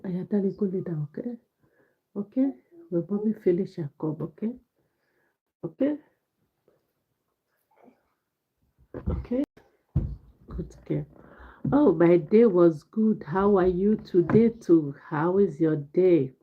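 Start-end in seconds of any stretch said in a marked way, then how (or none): 0.0-11.1 mother softly coaxing her daughter Aya to bed. Real, not acted. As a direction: hush her to sleep, softer. Mother hushing her little daughter.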